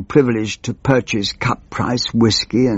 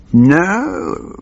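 mild Attlee no; 8 semitones higher than usual. Acted. Attlee answers the pilchard question.

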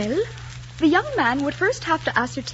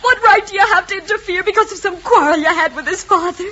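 loud, high, sobbing protest; distraught shouting through tears; tearful outburst; 8 semitones higher, 10 dB louder than usual. Acted. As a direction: cry it out in anguish and accusation. Crying niece confronts uncle.

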